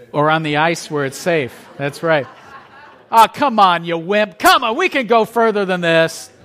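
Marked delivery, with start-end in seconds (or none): 3.1-6.3 mock taunt. Friends daring him on ice.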